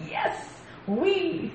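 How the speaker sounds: high, playful crowd cheer without volume; mock enthusiastic yell. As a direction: cheer like a crowd, held back. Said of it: "The crowd enthusiastically yelled".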